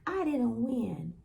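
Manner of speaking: retold crying. Boy whose team lost, mock tearful. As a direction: imitate a tearful child, lightly. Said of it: "And he's crying".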